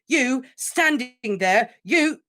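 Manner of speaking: choking furious shout. Acted. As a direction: sputter with fury. Harry raging at Dumbledore.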